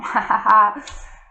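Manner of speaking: a short bright chuckle from the host; light laugh. Real, not acted. Host laughing at his own "Lenormand est grand" pun, light chuckle.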